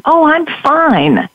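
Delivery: high, slow, forced-bright reply; perky fake fine. Answering the greeter while hiding her fear, mock perky and slow. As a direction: put on a brave perky front. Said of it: "in my best perky sign-up face".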